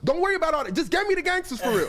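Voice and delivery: very high put-on star voice; impatient order in a high-pitched impersonation. Storyteller as the singer brushing off his people's doubts, high mock insistence.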